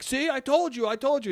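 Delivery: high, worked-up imitation of an angry dad; getting all mad. Dad telling mom he told her so, mock mad. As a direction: imitate an indignant I-told-you-so. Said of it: "He's getting all mad".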